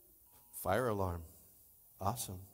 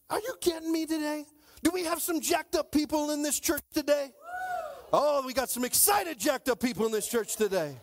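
hyping the crowd; 8 semitones higher than usual. Real, not acted. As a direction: fire up the room. Hyping up the congregation.

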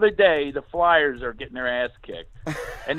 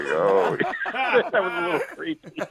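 normal talking voice

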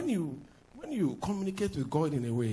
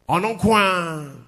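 drawn-out shout; 10 dB louder, much slower than usual. Real, not acted. Preacher shouts slowly.

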